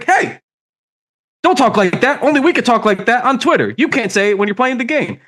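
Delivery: high-pitched imitation of outraged objectors; hammed-up telling-off, pitched high; mock indignant scolding. Mimics indignant reaction to Julius.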